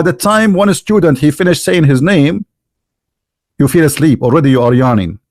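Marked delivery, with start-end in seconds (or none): none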